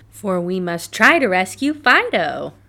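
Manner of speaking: loud, determined plea; urgent cry. A narrator's reading. Raggedy rallying them to rescue Fido, loud urgent cry. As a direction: call it out with urgency. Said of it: "Raggedy Ann cried".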